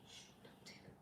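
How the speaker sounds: conspiratorial whisper; whispered secret aside. Pretend. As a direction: hush it like a secret. Secret aside about husband.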